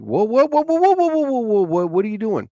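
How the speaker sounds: rising high-pitched whoas of alarm; mock alarmed whoa. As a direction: stop them in disbelief. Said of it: "it's like".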